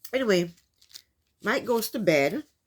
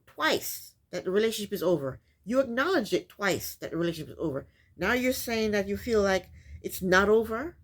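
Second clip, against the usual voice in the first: ordinary talk, nothing marked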